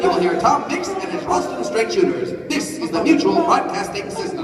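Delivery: put-on old-time announcer voice; radio announcer. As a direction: deliver it like a vintage network sign-off. Closing announcer read.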